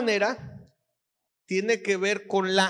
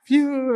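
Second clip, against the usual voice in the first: relief whistle; 6 semitones higher, 6 dB louder than usual. Pretend. Relief after pregnancy joke.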